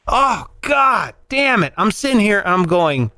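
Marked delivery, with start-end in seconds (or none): 0.1-1.7 exasperated. Real, not acted. Laughing exasperation at the memory.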